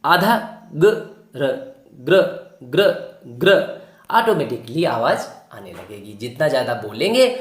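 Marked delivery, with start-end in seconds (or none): 0.0-3.5 आधा ग, then र, then ग्र said three times; blending drill. Shown as a demonstration. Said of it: "आप बोल के देखिए". (ग और र को जोड़कर ग्र बोलना)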